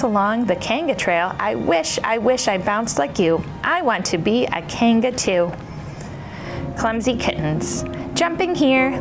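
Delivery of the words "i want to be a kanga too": usual speaking voice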